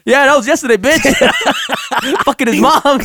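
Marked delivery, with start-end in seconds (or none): 1.1-2.2 squealing laugh; high-pitched laugh. Real, not acted. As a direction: shriek of laughter. Laughing at own joke.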